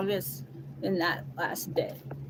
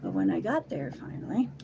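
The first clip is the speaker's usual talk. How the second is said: plain reading voice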